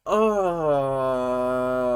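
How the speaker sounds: long low groan of frustration; angry groan. Real, not acted. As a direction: groan out your anger, drawn out. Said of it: "I'm so angry right now".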